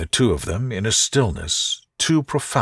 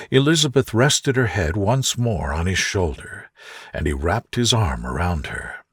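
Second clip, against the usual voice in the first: plain reading voice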